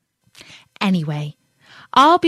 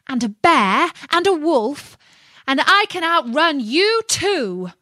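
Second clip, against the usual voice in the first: high, loud boast; boastful taunt; 8 semitones higher, 5 dB louder than usual. A narrator's reading. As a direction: crow it at the fox, pitched up. Gingerbread man boasts to fox.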